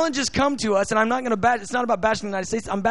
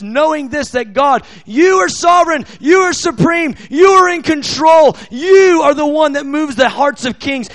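fervent prayer; 5 dB louder than usual. Real, not acted. Prays aloud, building to forceful declaration.

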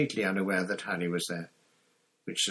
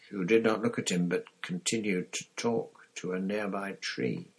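ordinary delivery

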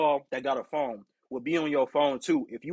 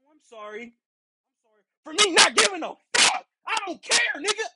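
defiant retort; 13 semitones higher than usual. Retold or imitated. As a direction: throw the fake apology back at her.

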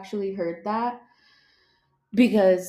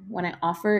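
normal talking voice

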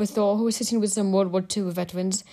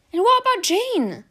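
high teasing voice; 12 semitones higher, 4 dB louder than usual. A narrator's reading. Tony teasing Thor.

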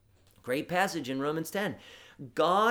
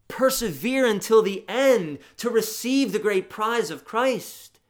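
louder, higher proclamation building up; preaching with rising force; 4 semitones higher, 5 dB louder than usual. Acted. Preacher urging his congregation, rising louder. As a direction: lift it like a call to the congregation.

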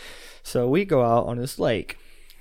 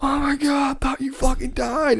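high, shocked mock relief; panicked buddy; 11 semitones higher than usual. Quoting Eric's shock at seeing him alive, high mock panic. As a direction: act out a friend who thought he drowned. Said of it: "And he was like".

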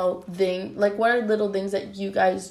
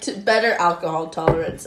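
ordinary talk

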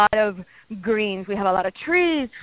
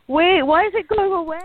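mock puzzled viewer, pitched higher; imitating a viewer; 8 semitones higher than usual. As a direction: voice a confused fan, higher up. Viewers' question quoted.

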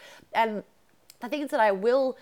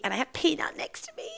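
whispered; 7 semitones higher than usual. Real, not acted. Dog beside her.

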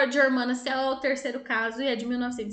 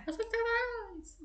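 a shrill, half-hearted madman's cry; higher mock yell; 11 semitones higher, 7 dB quieter than usual. Retelling the naked man's street cry, higher mock yell. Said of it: "saiu correndo pela rua pelado e gritando".

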